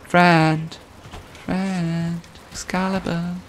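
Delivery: high, soft, drawn-out call; distant calling. Pretend. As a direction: call out thinly as if from far away. Calling a teammate who can't hear.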